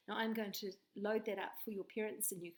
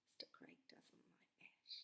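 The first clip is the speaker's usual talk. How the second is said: secret whisper; whispered aside; 14 semitones lower, 20 dB quieter than usual. Real, not acted. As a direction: whisper it behind your hand. Aside about husband.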